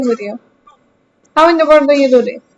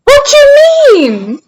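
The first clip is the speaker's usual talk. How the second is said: high, slow, drawn-out shout; indignant shout; 12 semitones higher, 5 dB louder, much slower than usual. Real, not acted. Called scary by friends, she shouts back slowly in disbelief. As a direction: stretch it out in loud disbelief.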